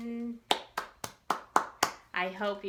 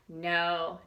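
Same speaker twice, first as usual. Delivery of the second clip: ordinary delivery, nothing marked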